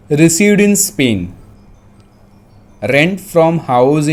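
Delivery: slow dictation, word by word; dictating. Real, not acted. Teacher dictating for students to write down, slow dictation. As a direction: give them time to write each phrase. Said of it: "मैं dictate कर रहा हूँ".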